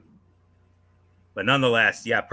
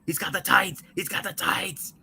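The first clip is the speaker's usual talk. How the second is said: high, strained mock scream; high-pitched outraged yelp held back in level; retold screaming; 8 semitones higher, 4 dB quieter than usual. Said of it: "you heard me screaming".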